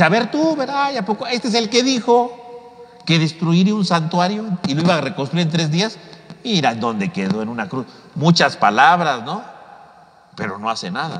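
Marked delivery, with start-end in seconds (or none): 0.0-6.0 high sneering taunt; taunting mockery. Passersby taunting Jesus on the cross, high jeering. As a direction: jeer up at him with scorn.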